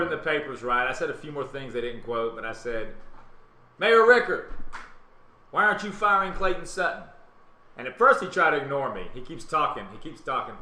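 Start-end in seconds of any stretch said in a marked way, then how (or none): 3.8-7.0 loud, high call across the crowd; retold heckling shout at the podium; shouted heckle. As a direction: yell it at the mayor over his speech. Heckling the mayor at event.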